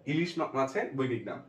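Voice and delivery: usual speaking voice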